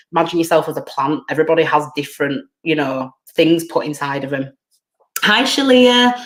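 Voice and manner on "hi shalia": high, loud hello to someone just arriving; bright greeting. Real, not acted. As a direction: call out a hello, pitched well up. Greeting a viewer who joins live.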